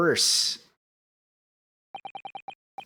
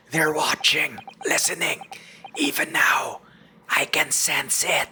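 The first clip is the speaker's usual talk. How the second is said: hushed, fearful whisper; paranoid whisper; 3 semitones higher than usual. Acted. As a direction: whisper as if being watched.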